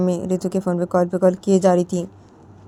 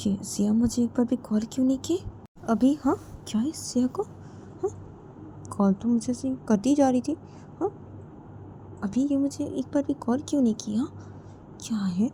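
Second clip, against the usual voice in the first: muttering to herself; 4 semitones higher, 10 dB quieter than usual. Acted.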